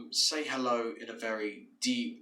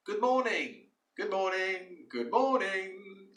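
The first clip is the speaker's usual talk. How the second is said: much higher, bright greeting, slowing at the end; cheerful good morning; 9 semitones higher, slower than usual. Shown as a demonstration. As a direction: sing out a friendly good morning. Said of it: "during the day, you say".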